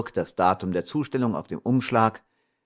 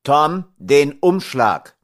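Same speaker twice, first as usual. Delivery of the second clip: loud, slow stern command; father sternly demanding the envelope back; strict father; 5 semitones higher, 8 dB louder, slower than usual. A narrator's reading. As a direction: lay down the law to the boy. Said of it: "rief der Vater streng".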